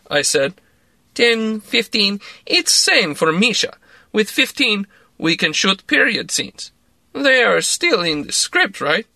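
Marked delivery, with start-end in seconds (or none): 1.1-9.1 put-on slow, lightly accented, coaxing; a hint of a Russian accent, talking him round; Russian woman voice. A narrator's reading. Larissa's voice, persuading.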